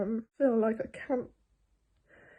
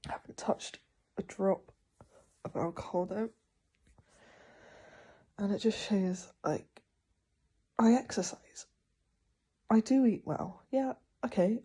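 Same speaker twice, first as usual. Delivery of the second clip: normal talking voice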